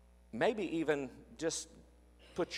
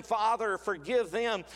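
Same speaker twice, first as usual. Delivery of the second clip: impassioned plea; 6 semitones higher than usual. Acted. Building Jesus' words from cross.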